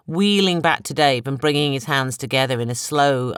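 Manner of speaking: usual speaking voice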